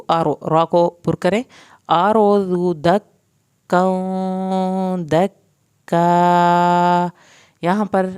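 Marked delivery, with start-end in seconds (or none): 1.9-7.1 slow, louder, drawn-out melodic tajweed recitation; Quran recitation. Shown as a demonstration. Teacher reciting the full verse for students, slow melodic recitation. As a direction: chant it out full and slow, stretching the long vowels.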